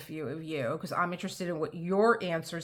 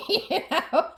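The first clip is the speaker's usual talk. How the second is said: bright laugh into a question; real laugh, higher and louder; 10 semitones higher, 6 dB louder than usual. Real, not acted. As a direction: laugh it off fondly. Laughing at grandmother's saying.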